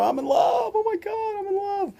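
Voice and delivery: hammed-up giddy squeal of being in love; imitating someone overcome by new love; mock lovestruck gush. Acting out giddy infatuation.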